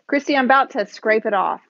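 ordinary talk, nothing marked

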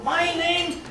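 put-on high, loud little-girl protest; angry little girl. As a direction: yell it like a furious six-year-old. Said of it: "with all the anger that a six-year-old".

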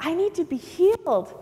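high-pitched protest; insistent plea. Acted. Blind man answering the crowd, high insistent plea. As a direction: plead over those hushing you. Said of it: "Bartimaeus is like".

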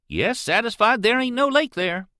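usual speaking voice

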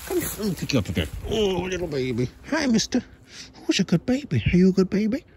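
high cooing pet talk. Real, not acted. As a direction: coo up high to the cat. Holding the cat.

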